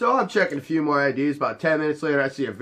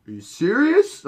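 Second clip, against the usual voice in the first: a much higher, louder disbelieving question; incredulous; 9 semitones higher, 4 dB louder than usual. Retold or imitated. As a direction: sound stunned he's asked this. Guy's incredulous reply.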